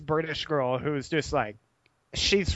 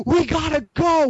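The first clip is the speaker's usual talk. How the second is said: higher and louder, yelling to hurry a friend; shouting at a sleepy buddy to get moving; frantic shout; 9 semitones higher, 6 dB louder than usual. Retold or imitated. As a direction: bark it like you're panicking about missing a train.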